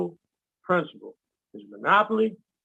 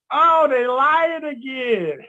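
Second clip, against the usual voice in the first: slow, higher, louder knowing drawl; mock weary joke; 6 semitones higher, 5 dB louder, much slower than usual. Telling listeners how to react to fraud, amused drawl. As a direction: roll your eyes at the liars. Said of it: "the first thing you gotta look at".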